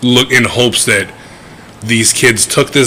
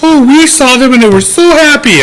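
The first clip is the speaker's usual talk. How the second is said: put-on high, loud, sweet old lady gushing; imitating old couple; 5 dB louder than usual. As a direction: gush like a chirpy old neighbour. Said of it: "the ones that were like".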